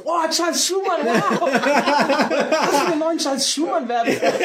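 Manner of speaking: high, laughing mock swoon over Schumann; excited fanboy gush. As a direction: gush like a starstruck fan. Retelling their own excited reaction. (aufgeregt schwärmend, mit Lachen)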